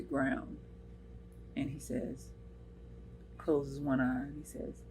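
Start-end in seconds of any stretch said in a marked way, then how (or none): none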